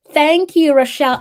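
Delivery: loud, high, delighted thank you; excited thanks. Real, not acted. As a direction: burst out with joy. Thrilled thanks to viewer.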